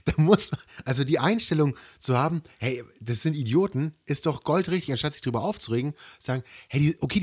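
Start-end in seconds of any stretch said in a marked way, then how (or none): none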